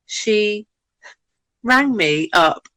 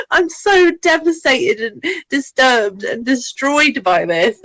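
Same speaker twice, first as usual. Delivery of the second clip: higher, slow, melodramatic despair; mock devastation; 7 semitones higher, slower than usual. Friend lamenting her discovery, mock despair. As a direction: ham up the tragedy.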